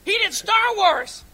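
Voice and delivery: high, excited fanboy gushing; excited geek. Retold or imitated. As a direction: gush like a hyped geek. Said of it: "all of us geeks were like".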